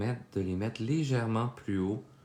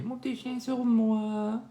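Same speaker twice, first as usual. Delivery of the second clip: much higher, cutesy voice for the little dog. Real, not acted. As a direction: coo it like talking to a puppy. Cooing over dog on her lap.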